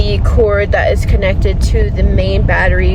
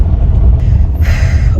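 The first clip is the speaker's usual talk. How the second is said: heavy sigh. Real, not acted. Upset about broken solar panel.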